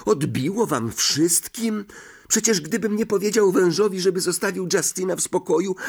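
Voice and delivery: high, exasperated protest; growing anger. Acted. Harry defends himself to his friends, exasperated and high-pitched. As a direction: protest with mounting irritation. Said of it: "że ogarnia go złość".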